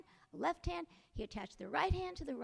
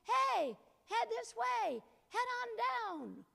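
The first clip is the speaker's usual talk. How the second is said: higher, slow drawn-out call; 9 semitones higher, slower than usual. A narrator's reading. Jack calls up the chimney to the head, slow and high. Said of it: "He called up again".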